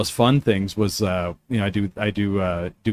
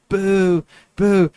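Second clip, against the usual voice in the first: crowd booing; 8 semitones higher than usual. Retold or imitated.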